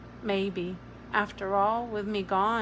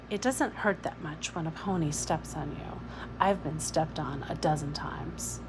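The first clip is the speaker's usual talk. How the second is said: soft, low whispered boast; quiet girl's whisper; hushed; 4 semitones lower, 6 dB quieter than usual. A narrator's reading. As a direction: keep it secret from the adults. Girl whispering back.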